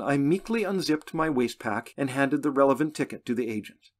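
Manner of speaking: ordinary delivery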